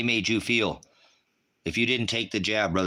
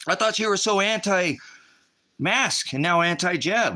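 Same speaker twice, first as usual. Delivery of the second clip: high, slow, sneering accuser voice; mocking accuser; 9 semitones higher, slower than usual. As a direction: parrot your critics with scorn. Voicing critics' accusation.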